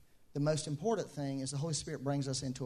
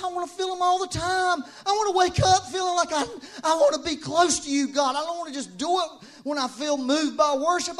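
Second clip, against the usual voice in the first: loud, high, driving sermon voice; full-force preaching; 10 semitones higher, 8 dB louder than usual. Acted. Preacher crying out to God before the church. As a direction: preach it out with fire.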